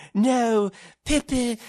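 very high, loud silly character cry; stupid made-up voice. Acted. Friends dicking around in their living room, stupid improv voice. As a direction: call it out in a daft, hammed-up high voice. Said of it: "you start making up stupid voices".

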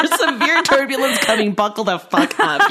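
mock-urgent crew announcement; urgent flight attendant. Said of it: "like, flight attendant voice".

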